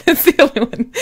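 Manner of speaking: laughing it out. Real, not acted. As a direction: giggle through the words. Laughs at joke.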